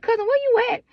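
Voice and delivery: high, loud, urgent question to the cousin; calling out for him in a panic; frantic call to cousin. Retold or imitated. Calling her cousin after grandma died, frantic and high. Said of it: "I was like".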